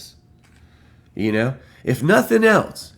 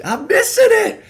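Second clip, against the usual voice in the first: much higher, louder whine with a laugh through it; laughing frustration; 6 dB louder than usual. Retold or imitated. Fan laughs at himself for missing the speech, wailing much higher. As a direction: wail it half laughing at yourself. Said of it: "I get all pissed".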